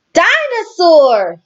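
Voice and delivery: put-on high, slow toddler word; high toddler dinosaur; George toddler voice. A narrator's reading. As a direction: say it proudly like a tiny boy.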